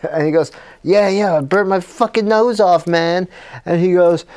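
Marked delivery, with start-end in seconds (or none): 0.8-3.3 higher-pitched, worked-up complaint; mock distress. Tony whose nose was burnt off, mock distressed. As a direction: whine about the damage, lightly hammed up.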